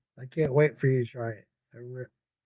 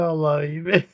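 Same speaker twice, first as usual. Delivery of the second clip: ordinary delivery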